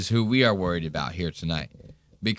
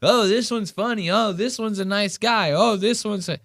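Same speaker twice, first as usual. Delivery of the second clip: higher-pitched fake gushing over colleagues; mock insincere praise; 10 semitones higher than usual. Sceptic imitating comedians praising each other, with mock insincere niceness. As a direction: imitate phony compliments in a raised voice. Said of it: "I never trust them saying".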